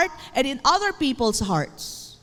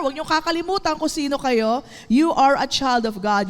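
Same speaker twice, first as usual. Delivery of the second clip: usual speaking voice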